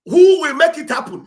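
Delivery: loud, high call to the listeners; rallying shout. Real, not acted. Activist rousing supporters to donate, loud shout. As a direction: shout the question at the crowd.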